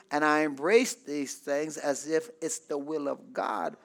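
soft, low musing with long pauses; wondering resignation. Acted. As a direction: think aloud quietly, as a doubting believer giving in. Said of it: "And they begin to wonder".

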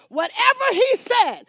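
loud, high preacher's declaration; forceful pulpit cry; emphatic preaching. Real, not acted. Preacher driving the point home.

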